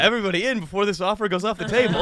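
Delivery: fast, pushy huckster pitch; sleazy salesman kidnapper. Pretend. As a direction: hurry them in like a carnival barker. Acting as a kidnapper luring kids.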